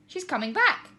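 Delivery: high and loud with excitement; excited. A narrator's reading. Tina watching the dog return, excited. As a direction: glad news blurted out. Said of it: "said Tina excitedly".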